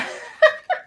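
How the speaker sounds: very high, loud wheezy laugh; high laughing fit. Real, not acted. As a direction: break into squealing laughter. Cracking up at own drawings.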